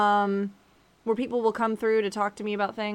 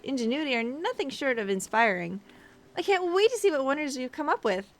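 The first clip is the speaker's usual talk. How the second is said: higher, enthusiastic character voice; perky boutique helper; 5 semitones higher than usual. Acted. Boutique worker praising the artisans, perky raised voice. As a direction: lift the pitch and enthuse.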